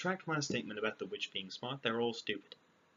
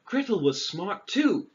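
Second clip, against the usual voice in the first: sarcastic; 9 semitones higher, 12 dB louder, slower than usual. Real, not acted. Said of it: "Wouldn't know that".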